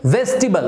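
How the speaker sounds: "वेजिटेबल" shortened to VEJ-ti-bul; "वेजिटेबल" as three syllables, careful model; not "table". Shown as a demonstration. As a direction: stress first syllable of "वेजिटेबल", weak ending. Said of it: "टेबल नहीं".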